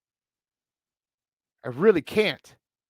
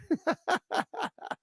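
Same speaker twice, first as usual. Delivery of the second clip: high breathy giggle at himself; amused laugh; 13 semitones higher, 7 dB quieter than usual. Real, not acted. Podcaster laughing at his own Chicago accent, amused giggle.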